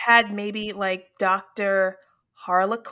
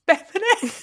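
higher mock Canadian lilt; badly faked Canadian; Canadian accent; 9 semitones higher, much faster than usual. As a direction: try out a silly Canadian accent. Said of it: "that Canadian accent".

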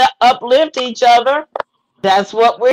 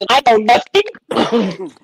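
slow, drawn-out comic catchphrase; Artie Johnson impression; much slower than usual. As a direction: do the old TV bit slowly. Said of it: "in the words of Artie Johnson".

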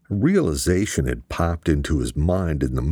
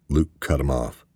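ordinary delivery

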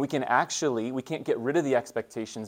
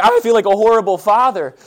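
much higher and much louder, a hammed-up wail of guilt; mock despair; 9 semitones higher, 14 dB louder than usual. Father retelling his guilt after school drop-off, hammed-up despair. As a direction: cry out your failure as a dad. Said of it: "And I was like".

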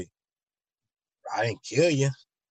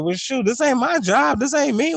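much higher, louder careless dismissal; dismissive shrug-off; 11 semitones higher, 4 dB louder than usual. Acted. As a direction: brush off the job as beneath you. Helper shrugging off work.

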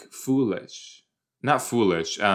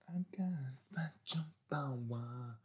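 whisper; 3 semitones higher, 18 dB quieter than usual. Real, not acted. Whispered aside watching show.

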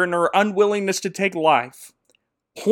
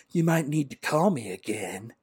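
awkward; 4 semitones lower, 8 dB quieter than usual. Retold or imitated. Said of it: "saying very awkwardly".

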